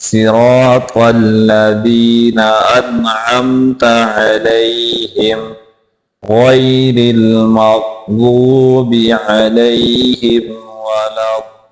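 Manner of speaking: slow, drawn-out tajweed recitation; measured model recital for learners; Quranic recitation. Shown as a demonstration. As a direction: recite the verse slowly, holding the long vowels.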